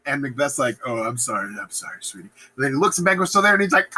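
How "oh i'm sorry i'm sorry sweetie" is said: low, softening apology; meek mock apology. Macbeth apologising to his scolding wife, low meek murmur. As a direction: shrink into a henpecked sorry.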